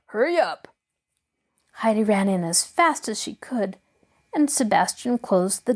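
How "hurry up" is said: impatient call. A narrator's reading. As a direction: hurry up repeated more urgently. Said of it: "he called out impatiently".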